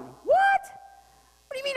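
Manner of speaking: shrill disbelieving what; mock surprise. The preacher playing a baffled disciple, mock surprise. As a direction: jump way up in mock shock.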